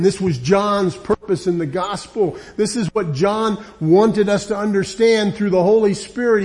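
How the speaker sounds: impassioned preaching. Acted. Preacher urging listeners to behold Jesus, impassioned. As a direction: press the crowd to behold their king.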